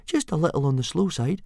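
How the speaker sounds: put-on high, placating boy voice; reassuring boy. A narrator's reading. Boy excusing himself to the instructor, placating. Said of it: "murmured Fishlegs reassuringly".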